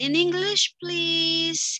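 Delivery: high, lively request; asking for English. Shown as a demonstration. Teacher asking students to answer in English, high. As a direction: nudge them to switch language. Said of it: "Pakai dalam bahasa Inggris coba".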